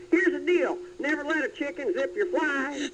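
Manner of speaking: clipped-up folksy Texas pitchman voice; Ross Perot impression. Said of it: "as Ross Perot".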